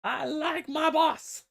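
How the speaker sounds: very high, drawn-out grot voice; mock little minion, high and slow; loyal grot. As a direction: squeak it out in stubborn loyalty. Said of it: "And he's like".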